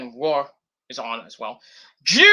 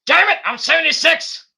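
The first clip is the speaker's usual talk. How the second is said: much higher, louder outburst; mock angry old boss; 10 semitones higher, 6 dB louder than usual. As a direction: rant like an old man who's been wronged. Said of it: "He's like".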